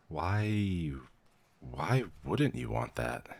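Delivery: slow, rising, disbelieving why; baffled question. Acted. Clerk baffled the new vampire refuses justice, slow and rising. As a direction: stretch it out in honest confusion. Turning by choice puzzles the official.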